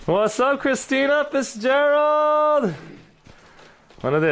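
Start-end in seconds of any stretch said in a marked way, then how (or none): 0.1-2.7 high, drawn-out greeting; excited streamer greeting, stretched at the end; hyped shout-out. Real, not acted. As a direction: call the name out long and high. Calls out a greeting to viewer.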